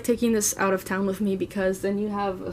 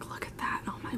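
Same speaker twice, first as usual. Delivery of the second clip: hushed whisper of admiration; breathless whispered awe; awed whisper; 14 dB quieter than usual. Real, not acted. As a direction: whisper it like you can't believe how pretty it is.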